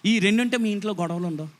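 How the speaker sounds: ordinary delivery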